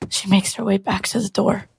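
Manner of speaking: ordinary delivery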